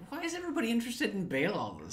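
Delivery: fast, high put-on voice; mock-baffled fan; puzzled squeak. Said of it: "I was like".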